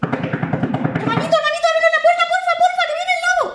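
very high squeaky piglet plea; pleading piglet. Acted. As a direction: beg in a tiny piglet voice. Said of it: "Llamaron a la puerta".

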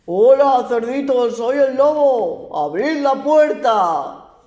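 loud slow wolf at the door; booming wolf. A narrator's reading. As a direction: boom it slowly like the big bad wolf. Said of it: "Y el lobo dijo".

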